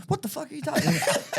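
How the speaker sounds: high-pitched mock outrage; retold outraged retort. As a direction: imitate an indignant relative firing back. Retold family standing up to argue.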